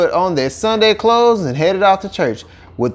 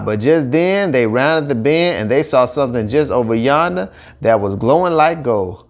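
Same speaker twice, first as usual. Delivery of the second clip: ordinary delivery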